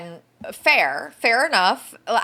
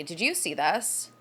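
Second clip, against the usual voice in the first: normal talking voice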